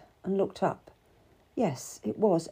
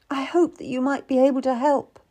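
higher, pleading hope; desperate; 7 semitones higher, 4 dB louder than usual. A narrator's reading. Wife still hopes for Seymour's help, desperate. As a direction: appeal with a touch of desperation. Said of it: "I asked desperately".

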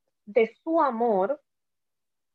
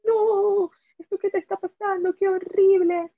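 much higher, exaggerated shocked pity; pitying acquaintance; 10 semitones higher, 4 dB louder than usual. Acquaintances hugging her after her diagnosis, gushing pity. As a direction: coo it like an overdramatic sympathizer. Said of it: "me abrazaba y me decía".

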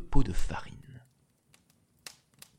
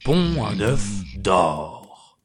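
very slow, louder ogre command; giant's command; 3 semitones lower, 5 dB louder, much slower than usual. Acted. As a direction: order the goose slowly and heavily. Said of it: "et dit". (ordre lent et grondant de l'ogre)

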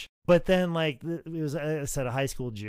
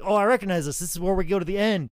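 excited retelling, higher and louder; jazzed; 6 semitones higher, 6 dB louder, faster than usual. Retold or imitated. Wrestler recognizing the planned finish, jazzed. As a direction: relive a thrilled realization. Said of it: "And I'm so jazzed".

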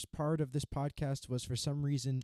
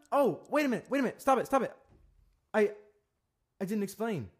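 flustered; 7 semitones higher, 5 dB louder, faster than usual. Real, not acted. Catches his own mistake.

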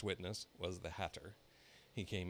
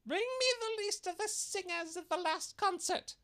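very high, loud shrill queen; imperious royal demand; 8 dB louder than usual. A narrator's reading. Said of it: "she said".

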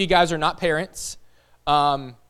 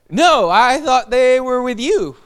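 higher, louder and slow, dawning panic; alarmed realization; 6 semitones higher, 6 dB louder, slower than usual. Retold or imitated. Father telling wife kids missing, slow rising panic. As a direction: let the fear land word by word.

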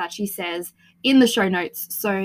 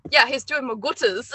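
lightly put-on Yorkshire accent, slightly raised; 4 semitones higher than usual. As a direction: imitate a Yorkshire aunt. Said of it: "one of my aunts, she was, like".

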